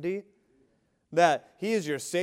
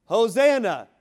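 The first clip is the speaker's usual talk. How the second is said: Hosanna shouted out, much higher and louder; shout of praise; 7 semitones higher, 11 dB louder than usual. Shown as a demonstration. Preacher crying out praise to the King, full shout. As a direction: cry Hosanna at the top of your voice. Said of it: "There should be an exclamation of praise".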